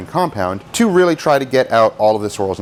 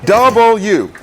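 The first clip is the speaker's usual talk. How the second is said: W loud and high, every syllable leaned on; W punched out as if it is hard work; syllables laboured; 9 semitones higher, 6 dB louder than usual. Shown as a demonstration. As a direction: hit each syllable of W hard, pitched up. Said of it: "there's more syllables in that".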